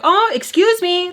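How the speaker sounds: loud, high, drawn-out call-out; really loud. Retold or imitated. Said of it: "like, really, really loud".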